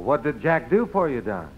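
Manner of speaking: ordinary talk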